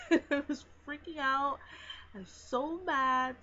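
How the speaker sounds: laughing while retelling. Retold or imitated. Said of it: "I was so emotional".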